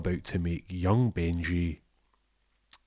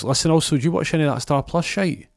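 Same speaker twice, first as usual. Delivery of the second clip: much higher, louder offhand question; cheeky change of topic; Jimmy asks Arjaf about Star Plus; loud casual ask; 9 semitones higher, 6 dB louder than usual. Acted.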